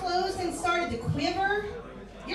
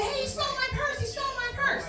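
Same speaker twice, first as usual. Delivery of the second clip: mock scream; 9 semitones higher, faster than usual. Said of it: "she's screaming".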